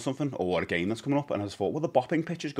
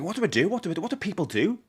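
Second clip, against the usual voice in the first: higher, fretful mock panic; 9 semitones higher, faster than usual. Retelling his month of not knowing what to do, in mock panic. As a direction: ask it like you're lost and panicking, half joking. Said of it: "All month, I was like".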